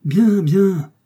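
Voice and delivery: high, loud exclamation; defiant cry. A narrator's reading. Captive answers her jailer's threat, crying out defiantly. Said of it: "m'écriai-je".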